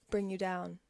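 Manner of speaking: normal talking voice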